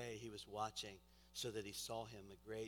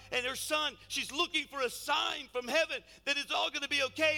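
impassioned preaching; 13 semitones higher, 12 dB louder than usual. Real, not acted. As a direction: preach the mother's anguish with full force. Preaches at full force.

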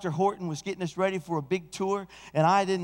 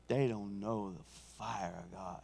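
quiet Elijah; 10 semitones lower, 11 dB quieter than usual. Acted. Elijah voiced low and quiet.

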